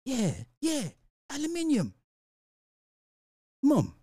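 high, soft put-on British voice; teasing high-pitched British impression; mock British accent. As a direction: imitate a prim Brit in a high voice. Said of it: "and you be like".

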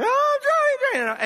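imitating his wife going under; panicked cry. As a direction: wail as the wave hits. Wife hit by another wave.

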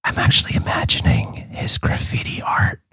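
soft, unhurried whisper; hushed, breath-only narration; ASMR whisper. Pretend. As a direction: whisper as if picturing it while drifting off. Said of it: "It's A S M R".

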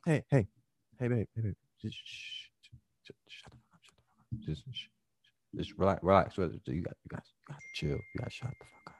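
hushed, soothing whisper; calm her down under your breath. Shown as a demonstration. Boyfriend hushing his girlfriend gently, soft whispering tone. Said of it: "You see how that tone is just softer".

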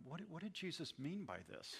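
softly probing question at the door; gentle, careful question. Shown as a demonstration. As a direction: ask it gently, like you don't want to spook them. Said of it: "Just gently and carefully say".

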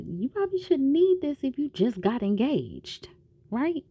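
teasing caution in a lifted voice; lightly voiced doctor, questioning; voicing the doctor. Fellow doctor gently warning Jack.

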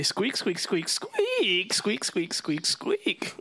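a player's milder squeak copy, climbing high at the end; imitating squirrel squeaks. Player repeating the squirrel's squeaks back, mildly squeaky. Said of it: "is what he said".

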